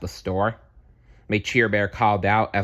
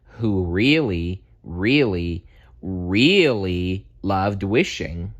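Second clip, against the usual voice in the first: slow stretched really really really; building emphasis; slower than usual. Acted. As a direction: lean on each really. Emphatic repetition, slowed.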